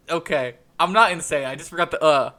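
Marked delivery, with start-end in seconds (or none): none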